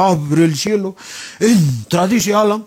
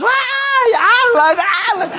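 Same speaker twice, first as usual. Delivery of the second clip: very high, excited cry of the name; mock fangirl squeal. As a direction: squeal like adoring fans mobbing their idol. Imita a chicas bonitas admiradoras. (chillido de admiradoras en broma)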